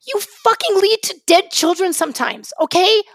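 real anger breaking through; pleading, heated protest; emotional outburst. Real, not acted. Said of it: "it's getting me very emotional".

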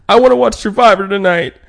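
high, loud whiny complaint; mock a sulking partner. Partner complains about TV choice, high and whiny.

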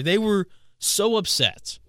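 delighted emphasis. Real, not acted. Gleeful about upset fans.

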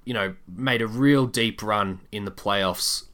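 usual speaking voice

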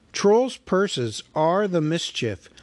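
usual speaking voice

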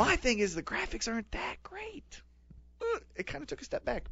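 hushed, breathy confiding whisper; whispered aside. Real, not acted. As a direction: drop to a secret whisper, as if sharing a confession. Quiet aside about the game.

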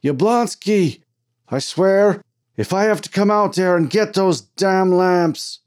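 high, loud, furious threat; fever-pitch shouting. A narrator's reading. Foreman threatening his miner in the tunnel, furious yelling. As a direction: holler a threat, drawing out the last word. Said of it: "The argument in the tunnel reached a fever pitch".

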